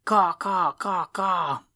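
loud high repeated caws; cawing crow call. Acted. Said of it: "he repeated".

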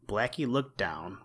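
ordinary delivery

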